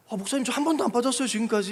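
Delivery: high, fast, imitated protest; protesting church member. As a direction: play a flustered member defending her record. (억울한 듯 높고 빠르게 항변하는 흉내)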